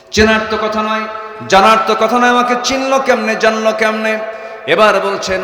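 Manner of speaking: high, loud sermon cry; impassioned high-pitched preaching; full-force preaching. Acted. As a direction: raise the voice and proclaim it to the crowd.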